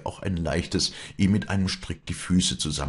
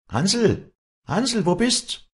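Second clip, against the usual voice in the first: high, drawn-out call; 5 dB louder, much slower than usual. A narrator's reading. Walli calls outside for her bird Hansl, high calling voice. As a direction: call for someone far outside. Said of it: "rief hinaus".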